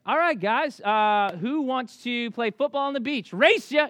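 rally the kids away with fake cheer; fake cheerful shout. Faking cheer to lure kids out.

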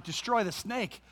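usual speaking voice

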